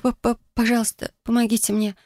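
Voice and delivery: stammering plea. Acted. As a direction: beg for help, stuttering with fear.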